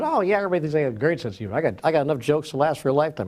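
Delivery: quick, keen retold boast; eager boasting. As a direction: imitate a man gushing proudly about himself. Man boasts eagerly about his humor.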